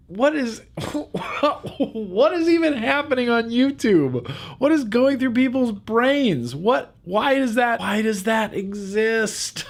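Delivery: high, incredulous outburst through real laughter; laughing disbelief. Real, not acted. Reacting to a lewd viewer comment, laughing in disbelief.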